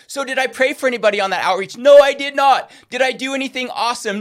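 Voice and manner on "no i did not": loud, punched admission; emphatic no. Real, not acted. Preacher answering his own question, loud. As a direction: hit the no hard.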